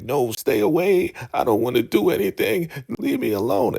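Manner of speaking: put-on high, pleading little-boy voice; frightened plea. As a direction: beg to be left alone. Frightened man cowering in corner.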